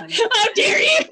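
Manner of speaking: high, hammed-up outraged shout with laughter; mock outrage. Mocking Brian's anger, high laughing mock outrage. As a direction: act wounded and furious.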